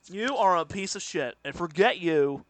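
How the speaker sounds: lightly imitated angry host; put-on indignant online commentator; Keemstar impression. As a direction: deliver a measured mock threat. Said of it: "That's not a bad impression".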